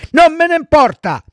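high, loud bratty retort; defiant child puppet. Acted. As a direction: throw it back like a stubborn kid. Pinocchio defiantly rejects the warnings. (bambino sfrontato, voce acuta)

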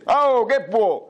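high, drawn-out comic retort; hammed-up insolent comeback; huntsman's rude reply. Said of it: "Il cacciatore pronto rispose".

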